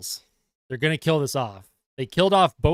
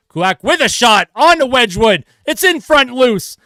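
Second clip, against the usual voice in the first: higher, louder scramble call, slowing on the loose puck; excited call; 8 semitones higher, 8 dB louder than usual. Acted. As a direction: rise with the danger in front. Scramble in front of goal.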